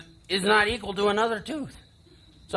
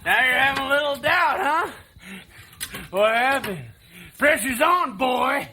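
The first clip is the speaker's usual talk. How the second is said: higher, louder needling; teasing older brother, raised and gloating; mocking taunt; 5 semitones higher, 4 dB louder than usual. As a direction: rub it in, enjoying his doubt.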